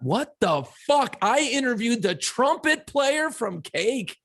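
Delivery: high, slow, indignant disbelief; mock outraged rant. Imitating his co-host fuming about getting the trumpet player, high and slow. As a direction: act out his partner storming out of the studio, fuming. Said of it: "like you're fucking lit up".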